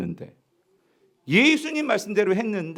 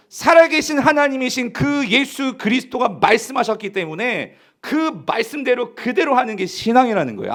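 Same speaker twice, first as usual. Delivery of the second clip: raised, louder declaration of faith; impassioned preaching; 5 semitones higher, 5 dB louder than usual. Real, not acted. Preacher proclaiming to believers whose word they obey, impassioned. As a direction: proclaim it to the whole congregation.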